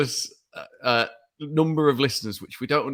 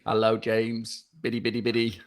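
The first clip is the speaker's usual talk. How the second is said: robot voice; 2 semitones lower than usual. Acted. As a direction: do a silly robot with beeping syllables. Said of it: "so I did my robot voice".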